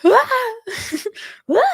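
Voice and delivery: high squealing laugh-cry; laughing mock cry. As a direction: yelp out in playful panic. Parts of self crying out, laughing.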